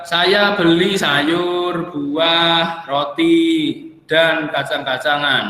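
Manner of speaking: slow, drawn-out items with separating pauses; deliberate listing. Shown as a demonstration. Teacher giving students a comma example, deliberate listing. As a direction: spell out the list item by item, unhurried.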